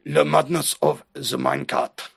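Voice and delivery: mock French accent. Shown as a demonstration. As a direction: put on a hammed-up French accent. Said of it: "That's my French accent".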